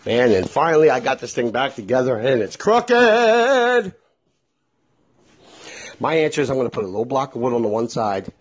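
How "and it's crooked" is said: exasperated drawl. Real, not acted. Exasperated punchline.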